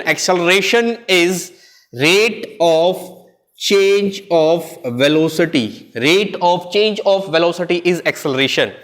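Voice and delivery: slow, word by word, for students to write down; slow dictation. Acted. Teacher dictating a definition to the class, slow and deliberate. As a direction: dictate it slowly so the class can copy.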